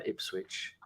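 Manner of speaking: breathy whispered reveal; a hushed, deadpan Ipswich. Acted. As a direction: drop the word to a hush. Ending prediction list, deadpan.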